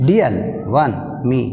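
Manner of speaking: ordinary talk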